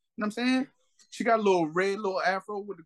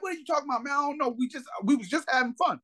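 retold pleading woman; 7 semitones higher than usual. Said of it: "She like".

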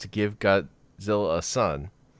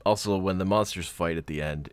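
usual speaking voice

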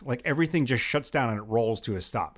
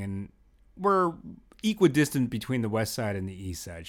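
ordinary talk, nothing marked

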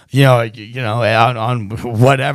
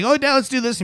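put-on higher eager little-kid plea; 13 semitones higher than usual. Son imitating himself asking dad, higher eager kid voice. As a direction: beg dad brightly.

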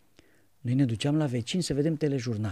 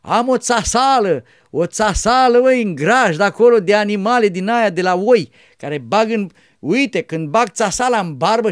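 put-on loud, high, animated old man; old monk explaining; 6 semitones higher, 12 dB louder than usual. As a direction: explain it loudly, full of life. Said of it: "zicea Cleopa, bătrânul".

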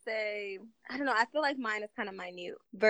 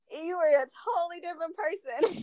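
usual speaking voice

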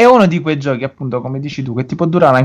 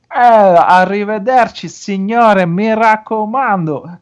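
high, slow, sing-song Italian greeting; 7 semitones higher, 3 dB louder, slower than usual. Retold or imitated. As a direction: ham up an old-world Italian shopkeeper. Imitating game NPC speaking Italian.

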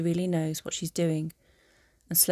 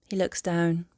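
ordinary delivery

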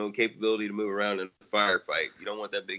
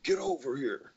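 high, strained battle cry; video game announcer shout. Pretend. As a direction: yank him in with a yell. Scorpion catchphrase quoted after.

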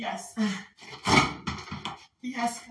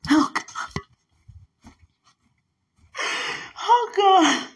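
rising praise cries; 5 semitones higher, 4 dB louder, slower than usual. Real, not acted.

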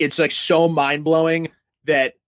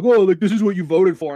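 smug mockery; 6 semitones higher, 4 dB louder than usual. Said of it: "laugh at Texas 'cause they're like".

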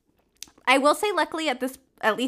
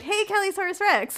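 calling out; 9 semitones higher than usual. Retold or imitated. Strangers calling her Facebook name.

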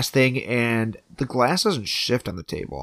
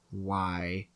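low, quiet mock disbelief; retold weary why; 5 semitones lower, 9 dB quieter than usual. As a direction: drop it low, imitating an unimpressed husband. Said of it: "Steve is like".

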